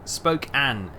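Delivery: SPOKANE as "spoke-ANN", long o, ending like "can"; corrected pronunciation. Shown as a demonstration. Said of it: "is how you say it".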